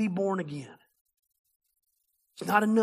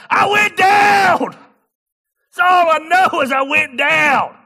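much higher, loud, slow, overcome shout; emotional outburst; 10 semitones higher, 13 dB louder, much slower than usual. Real, not acted. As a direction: cry it out overwhelmed with emotion. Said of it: "So now I'm getting all emotional".

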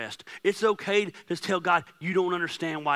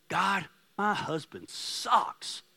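voiced prayer; 4 semitones higher, much slower than usual. Voicing a congregant's prayer.

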